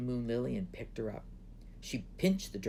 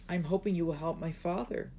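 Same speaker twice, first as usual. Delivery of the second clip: put-on shy, higher-pitched girl's plea; 7 semitones higher, 4 dB louder than usual. A narrator's reading. Princess shyly asking the dragon for help, shy. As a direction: lift the voice, a shy request. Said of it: "said Moon Lily shyly".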